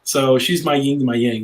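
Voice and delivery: ordinary talk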